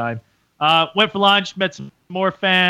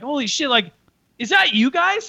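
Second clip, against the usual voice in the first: rising to a high squeak of disbelief; mock starstruck fan, high; imitating the fan's surprise; 9 semitones higher than usual. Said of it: "he's like".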